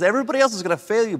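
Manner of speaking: boastful Peter. Retold or imitated. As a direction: rattle it off with proud certainty.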